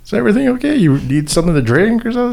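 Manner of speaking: high, solicitous questions; retold careful check-in; imitated gentle concern. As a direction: offer help to someone upset. Checking again on the mom.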